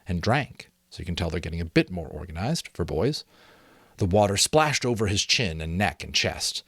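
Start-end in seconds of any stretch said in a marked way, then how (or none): none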